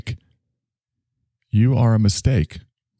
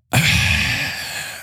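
smoke exhale sigh; 7 semitones higher than usual. Retold or imitated. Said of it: "You know, like that".

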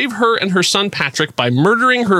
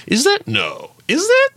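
surprised double take; 13 semitones higher than usual. Retold or imitated. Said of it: "I'm like".

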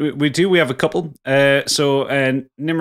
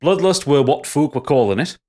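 put-on Yorkshire accent; 4 semitones higher than usual. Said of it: "nice Yorkshire accent needed for this one".

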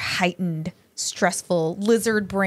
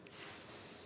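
soft unvoiced hiss on the exhale; snake hiss; 24 dB quieter than usual. Shown as a demonstration. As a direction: breathe out hissing like a snake. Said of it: "you could be a snake".

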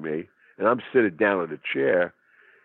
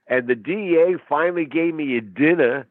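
normal talking voice

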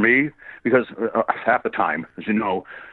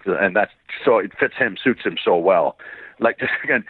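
normal talking voice

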